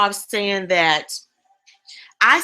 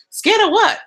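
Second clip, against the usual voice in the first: high, louder disbelieving question; incredulous challenge; 10 semitones higher, 4 dB louder than usual. Retold or imitated. Questioning her own fear, high incredulous. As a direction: scoff at the excuse.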